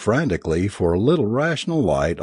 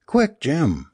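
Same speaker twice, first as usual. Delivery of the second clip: urgent cry, pitched higher; a touch of panic, voice raised in pitch; mild shriek; 7 semitones higher than usual. A narrator's reading. Alice urges Jim to help with the body, mild shriek. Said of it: "she shrieked".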